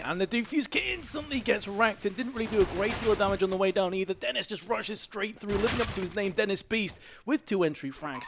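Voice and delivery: high-pitched, driving live commentary; excited play-by-play. Real, not acted.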